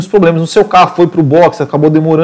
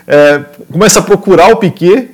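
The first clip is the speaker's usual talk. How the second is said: ordinary delivery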